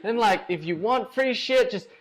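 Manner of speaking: high, excited American dude voice, pumped; impression of Rich. Drunk Rich gushing to the winner, high pumped dude voice. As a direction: gush like a drunk sponsor at an after party. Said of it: "He's like".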